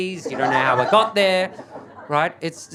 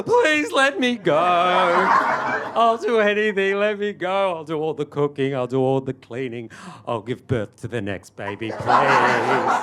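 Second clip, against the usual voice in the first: desperate begging; 4 dB louder than usual. Acted. Husband begging his wife to let him go, desperate mock wail. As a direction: beg frantically, promise after promise.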